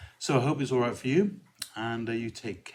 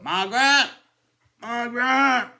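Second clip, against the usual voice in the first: much higher and louder call; sketch character yelling; 10 dB louder than usual. Retold or imitated. Little Britain shop character calling Margaret, loud high yell. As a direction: bellow across the shop. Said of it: "the pirate memory game in the shop".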